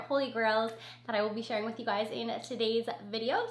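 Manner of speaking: usual speaking voice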